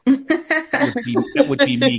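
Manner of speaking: high, loud, sheepish; self-mocking. Real, not acted. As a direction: own up with a mock wince.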